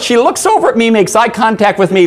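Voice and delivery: usual speaking voice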